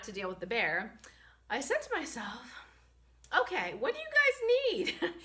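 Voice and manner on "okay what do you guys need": sweet high question. Retold or imitated. Asking animals kindly.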